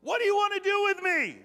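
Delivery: a demonized man crying out before Jesus; possessed man's shout. Acted. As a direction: scream it at the top of your voice. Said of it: "shouting at the top of his voice".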